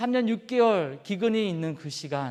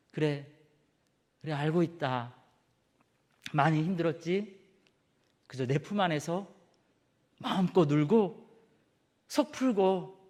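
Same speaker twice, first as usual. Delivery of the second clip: soft, low, tender reassurance; God's comfort; 4 semitones lower, 3 dB quieter than usual. Acted. God comforting Elijah in his arms, soft and tender. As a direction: hold him close with your voice.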